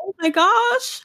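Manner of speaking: high, slow, moved "oh my gosh"; touched gasp. Retold or imitated. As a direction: melt at the sight, drawn out and high. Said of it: "And I was like".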